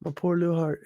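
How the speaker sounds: hammed-up wounded pout; mock hurt feelings. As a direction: play up a tiny broken heart. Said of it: "that shit hurt my feelings".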